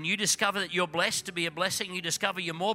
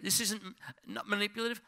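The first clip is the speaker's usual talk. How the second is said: ordinary talk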